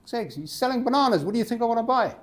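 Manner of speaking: high, incredulous comeback; acted exasperation at an obvious question; exasperated retort. Acted quarrel, customer retorts.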